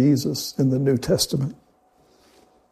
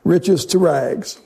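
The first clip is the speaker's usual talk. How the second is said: slow refrain; 3 semitones higher, much slower than usual. Acted. Sermon refrain, slowed.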